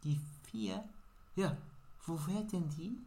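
lightly voiced timid stranger asking the way; a hint of a shy little woman; small woman asking. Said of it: "small woman asks back".

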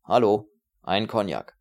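loud, slow call across the café. A narrator's reading. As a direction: hail the passing waiter. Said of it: "Er rief einen vorüberhuschenden Kellner an".

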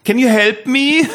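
high, slow put-on voice, English; prank asking tourist. Schoolboy pranking an English passer-by, silly high plea. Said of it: "zu Leuten gegangen sind und gesagt haben".

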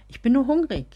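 gereizt, laut und hoch; irritated tone. A narrator's reading. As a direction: snap it out, fed up and hungry. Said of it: "sagte sie dennoch im gereizten Ton".

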